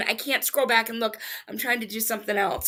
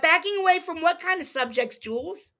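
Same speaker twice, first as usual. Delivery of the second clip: normal talking voice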